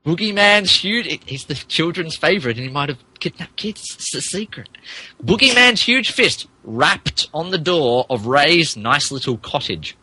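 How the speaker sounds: usual speaking voice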